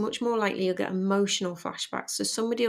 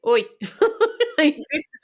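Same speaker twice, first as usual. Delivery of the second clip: laughing, high cheeky "Oi"; mock grown son; 7 semitones higher, 9 dB louder than usual. Grown son calling his mother out, laughing and much higher. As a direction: playfully voice the son calling her out, laughing through it.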